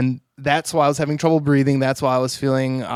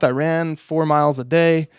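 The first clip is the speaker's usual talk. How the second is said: normal talking voice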